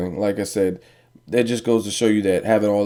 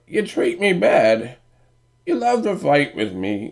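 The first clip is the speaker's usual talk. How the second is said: high, slow, whining crybaby voice; mock him as a whiny crybaby; 8 semitones higher, 3 dB louder than usual. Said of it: "he just sound like, like a crybaby".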